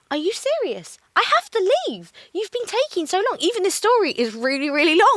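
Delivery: high-pitched, incredulous protest; exasperated complaint. Retold or imitated. Acted complaint in retold quarrel.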